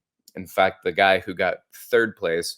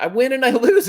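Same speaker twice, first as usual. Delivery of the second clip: laughing; 8 dB louder, faster than usual. Real, not acted. Laughs at own point.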